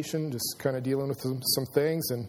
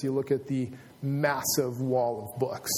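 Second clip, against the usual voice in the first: normal talking voice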